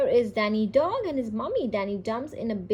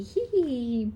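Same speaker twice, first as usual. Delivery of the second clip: high-pitched hee-hee; storybook giggle; 4 semitones higher than usual. A narrator's reading. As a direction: giggle lightly as Peppa, pitched up. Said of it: "Peppa loudly".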